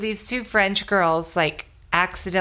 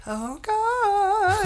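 dismayed; 14 semitones higher than usual. Retold or imitated. Her inner reaction.

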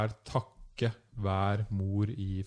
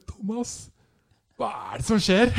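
much higher, louder, overwhelmed call to a friend; overwhelmed cry out; 12 semitones higher, 10 dB louder than usual. Retold or imitated. Tourist turning to her Norwegian friend mid-ritual, overwhelmed high cry. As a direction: call out to Thomas in overwhelmed disbelief.

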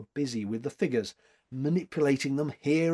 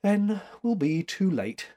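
plain reading voice

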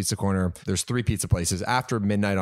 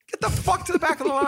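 high furious yell. Retold or imitated. Telling the line-cutters off, a high furious yell. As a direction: order them to the back with a yell. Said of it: "I'm like".

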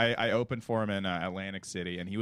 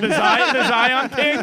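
excited pun; 10 semitones higher, 9 dB louder, slower than usual. Real, not acted. Pun shouted laughing.

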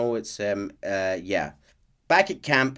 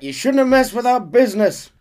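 loud high laughing yell; shouting back; 9 dB louder than usual. Acted. Manor men yelling back at Dutch's gang. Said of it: "the men shout back".

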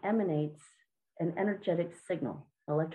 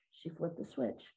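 ordinary talk, nothing marked

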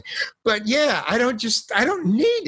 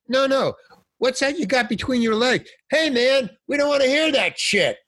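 heckler yelling; 9 semitones higher than usual. Retold or imitated. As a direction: shout him down, fed up. Heckler shouting from nightclub crowd.

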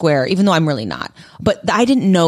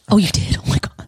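whispered shock. Real, not acted.

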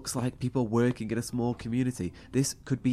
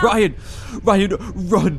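much higher, much louder yell to flee; frantic warning cry; panicked shout; 7 semitones higher, 11 dB louder, slower than usual. Acted. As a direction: scream at him to run. Warning his friend, panicked.

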